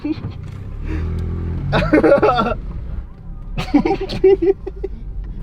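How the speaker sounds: long, high laughter; can't stop laughing; laughing fit. Real, not acted.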